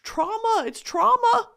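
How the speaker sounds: high, tearful cry on trauma. Real, not acted. Host describing years of religious trauma, tearful. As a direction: push trauma out through tears, high and breaking. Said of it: "sorry for crying".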